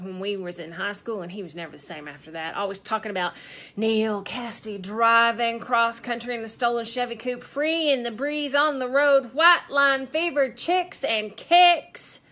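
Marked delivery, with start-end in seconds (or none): 3.8-12.2 mock rapture. Wife mimics husband always talking about the road, mock rapture. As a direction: parody his road-dream raving, swelling to the last word.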